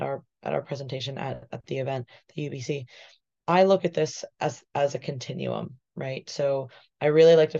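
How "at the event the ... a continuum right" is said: normal talking voice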